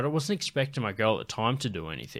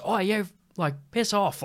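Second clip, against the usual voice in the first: higher-pitched Irish brush-off; thick Irish accent; 9 semitones higher than usual. Retold or imitated. Irish woman telling a pestering stranger to go, thick Irish accent. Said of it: "with this fucking thick Irish accent".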